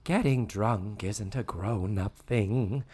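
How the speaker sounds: slow, drawn-out moralizing; Mrs. Garrett imitation. Host as Mrs. Garrett giving Tootie a moment of wisdom, slow mock-earnest. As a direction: stretch it into a gentle sitcom lesson. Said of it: "then we get the Missus Garrett".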